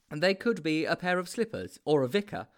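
a hint of a self-appointed detective; Mildew's explaining voice. A narrator's reading. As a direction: explain patiently as the expert friend.

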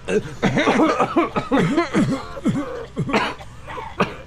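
exaggerated fake coughing fit; faked coughing. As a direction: cough repeatedly, putting on illness. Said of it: "We just gotta cough".

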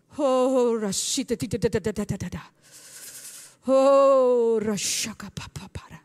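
loud drawn-out cries breaking into rapid syllables; ecstatic prayer. Real, not acted. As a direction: pray in tongues with long, loud exclamations.

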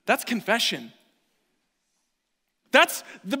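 a loud, high sermon cry; full-force preaching. Acted. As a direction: preach it hard to the room.

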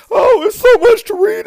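high, loud mock whine. Mocking someone overwhelmed by texts.

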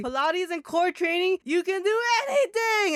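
high, hammed-up fitness-mom cheer; mock excited mom. Quoting mom lifting Bandit.

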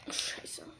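whispered curse. Real, not acted. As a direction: breathe the curse, no voice. Swears to himself in game.

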